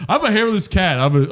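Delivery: normal talking voice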